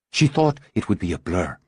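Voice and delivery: ordinary delivery, nothing marked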